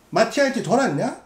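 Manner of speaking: mock sneer. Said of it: "이거라니까".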